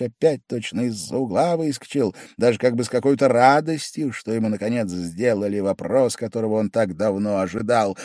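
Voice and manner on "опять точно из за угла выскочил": usual speaking voice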